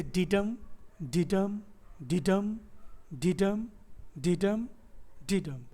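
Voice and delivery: each "डिडम" separate, same tune, the last one dropping; iambic pentameter rhythm. Shown as a demonstration. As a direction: chant "डिडम" in evenly spaced beats, "डम" stressed. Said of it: "तो ये पांच बार".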